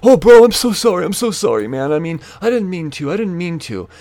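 high, mock-pleading apology with a loud start; imitating placating apology. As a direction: imitate him begging to defuse things. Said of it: "he's trying to diffuse the situation".

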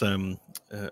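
usual speaking voice

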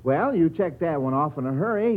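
usual speaking voice

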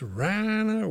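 high Southern call of "Rana", vowel stretched with Y sounds; exaggerated Southern twang. Bunkmate calling across at night, mock Southern drawl. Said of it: "with a lot of Y's".

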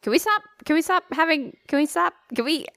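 high, pleading whine; whiny plea. Real, not acted. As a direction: beg her to stop. Begging co-host to stop gross talk.

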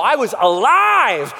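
ordinary delivery

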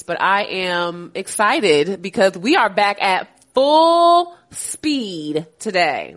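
ordinary talk

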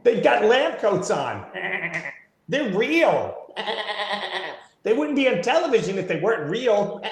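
high-pitched, laughing through the words; mocking while cracking up; laughing sarcasm. Real, not acted.

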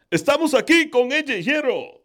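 loud high-pitched announcer call in Spanish; Spanish wrestling announcer. Shown as a demonstration. Host showing the Spanish wrestling announcer voice. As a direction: belt it out like a ringside announcer. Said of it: "the Spanish announcer".

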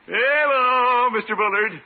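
high, loud, drawn-out fake-friendly hello; sugary greeting. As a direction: sing it out with syrupy politeness. Overly sweet greeting to neighbor.

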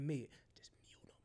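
soft whispered instruction; hushed, sneaky aside; conspiratorial whisper. Retold or imitated. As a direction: whisper it so the caller cannot hear. Secret instruction to producer on call.

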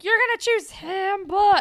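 loud, high, hammed-up accusation; mock outrage. Friend retelling Jesse confronting them over her choice, loud and mocking. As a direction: throw it out as a mocking protest. Acted jeer in retold love triangle.

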